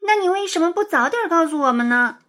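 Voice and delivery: put-on high, plaintive child's reproach; aggrieved. A narrator's reading. Pupil asks why teacher kept it secret, aggrieved. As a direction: ask it like a wronged little pupil. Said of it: "陆美美委屈地说".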